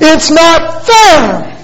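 high, long-drawn mock wail of injustice; devastated boy. Son insists to dad on the injustice, mock devastated. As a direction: imitate a child crying out that he's been wronged. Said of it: "he's emotional, and there's tears in his eyes".

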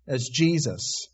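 ordinary talk, nothing marked

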